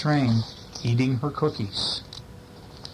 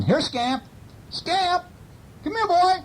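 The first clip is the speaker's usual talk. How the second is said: calling for the dog. A narrator's reading. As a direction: call out for your lost dog. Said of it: "hears Orville calling".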